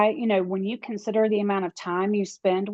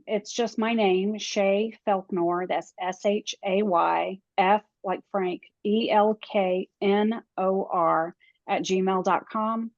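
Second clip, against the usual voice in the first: slow and clear; slower than usual. Shown as a demonstration. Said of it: "nice and slow".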